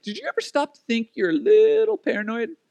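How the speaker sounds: high, teasing mock-wife voice; imitating wife. Wife questioning husband's hearing, high and teasing. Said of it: "And she's like".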